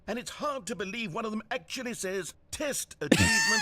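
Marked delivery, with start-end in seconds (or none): 2.5-3.6 sudden mock shout. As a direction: blurt it out at the top of the voice. Narrator reading a silly achievement.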